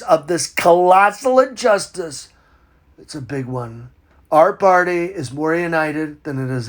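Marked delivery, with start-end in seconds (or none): 3.0-3.9 low, soft throwaway mutter; muttered aside. Acted. Comedian mutters an aside while reading the letter. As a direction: toss it off under your breath.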